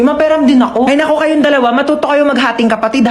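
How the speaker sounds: ordinary talk, nothing marked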